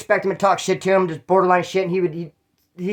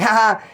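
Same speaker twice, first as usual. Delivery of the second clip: higher mock "ha ha" laugh; re-enacted laugh; 4 semitones higher, 4 dB louder than usual. Retelling how he laughed at the yelling, mock laugh. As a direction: laugh it off, put on. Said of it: "but I laughed".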